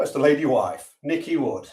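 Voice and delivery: normal talking voice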